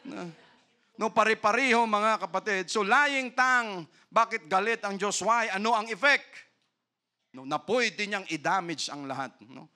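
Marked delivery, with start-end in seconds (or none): 1.0-6.2 fervent preaching. Acted. Preaching at full force.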